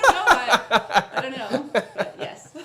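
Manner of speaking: bursting into loud laughter mid-words; laughing hard. Real, not acted. Laughing at the bed joke.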